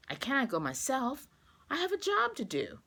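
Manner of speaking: high, urgent pleading; begging wife. Acted. June begging Luke to rush the egg to the bank, pleading. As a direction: push him out the door, pleading. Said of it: "begged June".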